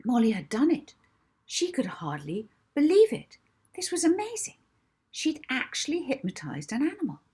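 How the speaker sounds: excited disbelief; amazed. A narrator's reading. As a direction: sound delighted and astonished. Molly's amazed triumph.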